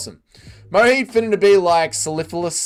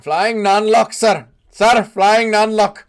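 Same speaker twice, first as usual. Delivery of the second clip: mock Indian accent; 6 semitones higher, 7 dB louder, slower than usual.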